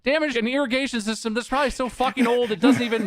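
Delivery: high-pitched, incredulous venting; outraged rant. Real, not acted. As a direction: let indignation hold your voice up high. Outraged rant about the deliveryman.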